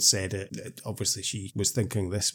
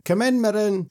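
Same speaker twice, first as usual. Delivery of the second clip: high, sing-out call of a mother; imitated mum's high-pitched call; 11 semitones higher, 3 dB louder than usual. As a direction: call your child indoors from the doorstep. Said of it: "you just hear Mirren's mum going".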